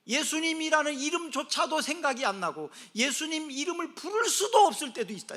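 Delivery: loud, high, forceful delivery; impassioned preaching. Acted. Preacher describing being unable to call Jesus, impassioned. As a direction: press the helplessness on the listeners.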